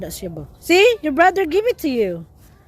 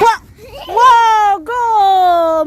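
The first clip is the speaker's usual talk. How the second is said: loud, high, stretched cheer; high, loud wow into a go; excited amazement; 6 semitones higher, 4 dB louder, much slower than usual. Real, not acted. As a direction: burst out cheering for the little player. Cheering child's successful kick.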